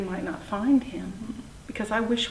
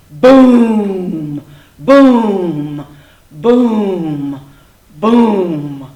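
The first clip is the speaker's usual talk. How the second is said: loud, evenly spaced falling booms; booming footsteps; 12 dB louder, much slower than usual. A narrator's reading. Sharp-toothed dinosaur leaves, heavy resounding booms. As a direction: sound out each heavy departing step.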